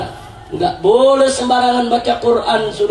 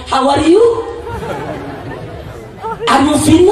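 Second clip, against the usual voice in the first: mock English in a local Sundanese accent; 6 semitones higher than usual. Someone refusing to be outdone in English, mock Sundanese accent. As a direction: imitate Sundanese-accented English, questions lifted at the end. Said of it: "langsung dia ngomong".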